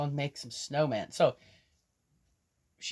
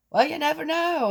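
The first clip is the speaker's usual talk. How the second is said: put-on much higher, louder little girl voice; granddaughter's voice; 14 semitones higher, 8 dB louder than usual. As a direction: insist like a worried child. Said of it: "I said".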